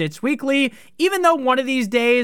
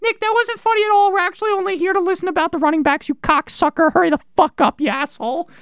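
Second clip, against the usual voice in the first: high, louder whiny heckler, rushed in the middle; mock annoyed commenter; 12 semitones higher, 3 dB louder than usual. Imagined commenter scolding Nick to hurry up, mock annoyed high heckler voice. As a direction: sneer it like an impatient troll in the comments. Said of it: "some people in the comments be like".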